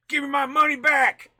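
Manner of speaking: high, very loud mock demand; hammed-up shouted complaint; Joey. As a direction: yell it like an outraged customer. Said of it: "Now Joey's gonna be like".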